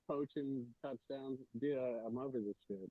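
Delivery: faint, dropping connection audio; audio cutting out. Real, not acted. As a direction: voice cutting out on a bad line. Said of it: "your audio is cutting out there".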